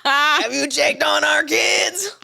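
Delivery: slow, strained put-on husband voice with a cry in it; Harold character voice. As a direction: ham up a panicky husband calling his wife. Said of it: "What was that voice?".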